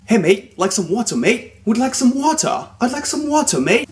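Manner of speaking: high-pitched, overplayed British accent; exaggerated British accent. Shown as a demonstration. Teacher showing viewers an overdone accent, exaggerated British. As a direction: ham up a British accent to the limit. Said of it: "prendre un accent britannique".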